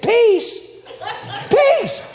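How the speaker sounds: high, loud, half-laughing disbelief; incredulous shout. Retold or imitated. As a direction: yell it back at her, high and loud with a laugh. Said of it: "I'm freaking out on her".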